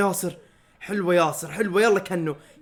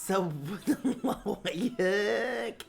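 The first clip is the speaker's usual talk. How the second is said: slow curse breaking into a laugh; laughing mock curse; 7 dB quieter, much slower than usual. Real, not acted. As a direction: drag it out and laugh through it. Fan mocks a player, laughing.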